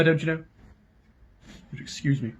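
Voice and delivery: ordinary delivery, nothing marked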